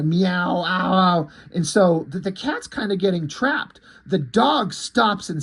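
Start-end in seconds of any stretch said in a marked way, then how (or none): 0.0-1.3 mock cat yowl; drawn-out meow breaking into ows; screaming meow. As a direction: imitate a meowing cat in pain, half-hearted. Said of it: "kind of screaming slash meowing".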